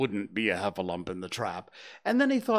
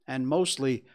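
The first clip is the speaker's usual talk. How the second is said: ordinary delivery, nothing marked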